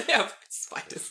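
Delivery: high, laughing repeat of the spider line; giggling mock summary; laughing echo. Real, not acted. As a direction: laugh the line back at them.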